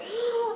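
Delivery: sharp shocked gasp; high gasp. Retold or imitated. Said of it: "the kids are like".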